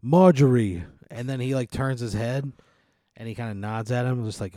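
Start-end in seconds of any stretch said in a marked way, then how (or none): 0.0-0.8 put-on loud, slow Italian-accented gripe; loud Italian complaint. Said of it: "he's like".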